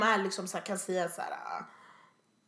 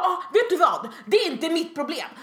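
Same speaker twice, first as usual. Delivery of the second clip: higher and louder, half-laughing rant; mock angry customer; 5 semitones higher, 6 dB louder than usual. Retelling a customer who yelled at the counter, mock angry. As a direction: throw it back at her like a furious customer. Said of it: "började skrika på mig".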